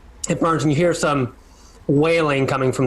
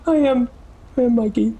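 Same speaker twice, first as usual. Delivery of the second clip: much higher, simple creature voice; a big gentle beast saying his name; Mikey's voice; 9 semitones higher, faster than usual. Acted. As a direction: sad beast saying goodbye to the party.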